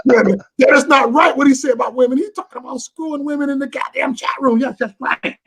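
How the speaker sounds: high, loud scandalized listeners; mock outraged chatter. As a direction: shriek like the scandalized chat room, hammed-up. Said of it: "So they're like".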